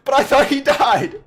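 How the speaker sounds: high, very loud, drawn-out fake dismay; mock shocked yell. Vlogger joking about Ninja's meme death, mock horror. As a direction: wail it in hammed-up shock.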